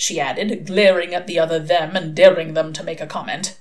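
plain reading voice

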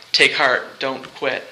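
usual speaking voice

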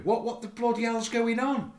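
high, exasperated burst of frustration; exasperated outburst. Real, not acted. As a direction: let the anger slip out in disbelief. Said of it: "It's slipping out".

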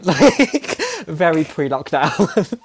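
laughing through the words. Real, not acted. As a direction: giggle it out, slowly. Laughs at own joke.

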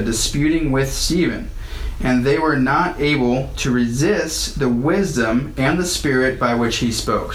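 ordinary delivery

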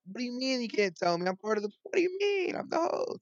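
high-pitched, incredulous complaint; playfully outraged, voice way up high; mock indignant protest. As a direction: protest in a squeaky, put-out pitch. Mock protest at kept secret.

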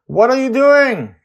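put-on high loud yell after the kids. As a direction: call them back sharply. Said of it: "I yell at them".

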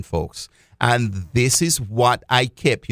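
usual speaking voice

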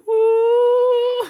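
a long, soaring high "Ooh" like a hyped-up studio audience; mock crowd ooh. Mocking the studio audience's reaction, a hammed-up high ooh. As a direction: swoop way up and ham up the crowd's ooh. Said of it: "the audience is like".

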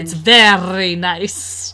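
put-on slow, failed Scottish accent; badly faked Scottish voice; attempted Scottish accent. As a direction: try for Scottish, land somewhere else. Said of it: "That was an accent I tried to do".

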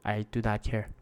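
muttered indifference. Retold or imitated. Fed-up fan telling himself he doesn't care, muttered softly. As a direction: mutter it like you've given up caring. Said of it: "but I'm just like".

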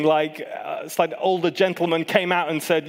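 ordinary talk

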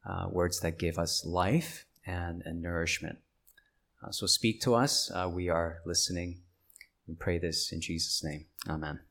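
quiet, halting spoken prayer. Real, not acted. Pastor asking God to move hearts, hushed prayer. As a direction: pray softly, with pauses to find the words.

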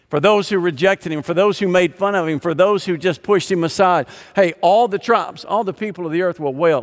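emphatic repeated for those, pushed hard; full preaching. Acted. Preacher warning the congregation, full force preaching. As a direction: pound each for those like a preacher.

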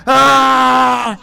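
long, loud, high scream; mock scream. Comedian resumes the row after a selfie, long mock scream. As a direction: burst back into a sustained yell.

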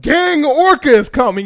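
high, loud acted panic; mock alarm. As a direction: call out the threat in pretend dread. Acting a villain spotting a hero.

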